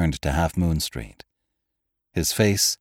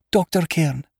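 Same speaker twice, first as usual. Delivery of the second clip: ordinary delivery, nothing marked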